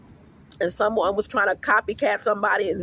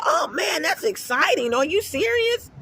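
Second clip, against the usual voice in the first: mock excited man; 8 semitones higher than usual. Mimicking the husband's excited reaction.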